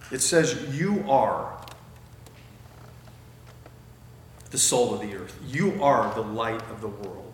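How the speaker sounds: preacher affirming the congregation; stress on you are. Shown as a demonstration. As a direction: land each "you" firmly. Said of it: "Not you will become, but you are".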